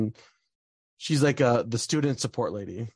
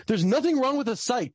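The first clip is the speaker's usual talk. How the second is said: pitched-up indignant insistence; exasperated retelling; 11 semitones higher than usual. Retold or imitated. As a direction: voice jumps much higher, insisting.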